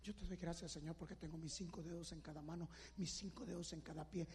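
low murmured prayer swelling to a loud cry; grateful prayer building up. Shown as a demonstration. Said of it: "¿quién oró esta mañana así? Así".

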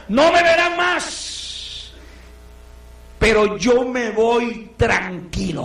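high, very slow, drawn-out farewell; Paul's farewell. Acted. Preacher voicing Paul bidding the church farewell, slow and high. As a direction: stretch out no me verán más high and slow. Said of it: "Oiga usted cómo dice Pablo".

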